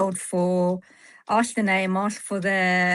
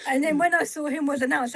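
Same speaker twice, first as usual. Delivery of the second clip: ordinary delivery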